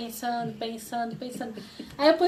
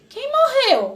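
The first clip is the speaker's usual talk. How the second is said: alarmed; 12 semitones higher, 7 dB louder, slower than usual. Retold or imitated. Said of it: "ele olhou".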